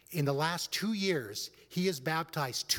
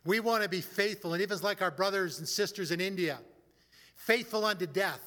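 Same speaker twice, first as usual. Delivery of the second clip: earnest prayer; 3 semitones higher, 3 dB louder than usual. Acted. Pastor leads the congregation in prayer, earnest and measured.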